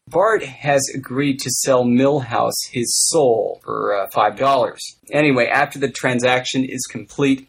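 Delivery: normal talking voice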